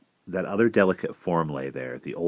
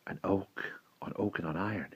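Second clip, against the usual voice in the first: very soft, hushed repetition; whispered; 12 dB quieter than usual. A narrator's reading. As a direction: whisper it, far below speaking level. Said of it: "he whispered".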